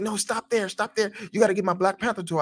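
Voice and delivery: put-on high, fast bossy child; demanding little son. Son passing Walmart, high and fast, ordering dad to stop. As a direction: insist like a kid who wants it now.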